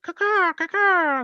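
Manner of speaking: high squawking caw-caw noise; imitated noise. Shown as a demonstration. Demonstrating a noise on request, high mock squawk. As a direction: squawk out a loud, high bird-like caw, twice. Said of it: "This noise is gonna come out my face".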